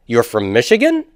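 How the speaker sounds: "You're from Michigan" as a question, lifted on "Michigan"; rising question intonation. Shown as a demonstration. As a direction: make the statement ask, pitch climbing through "Michigan". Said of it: "I raise the intonation upwards".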